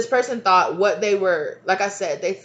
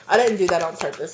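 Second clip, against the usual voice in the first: normal talking voice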